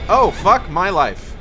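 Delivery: loud exasperated cursing; frustrated outburst. Real, not acted. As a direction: blurt it out, fed up. Monster drains willpower, cursing.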